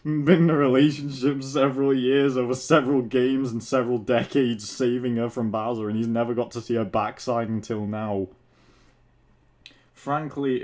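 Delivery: ordinary talk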